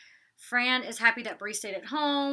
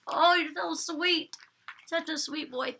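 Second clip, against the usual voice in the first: tender praise to dog; 6 semitones higher, slower than usual. Real, not acted.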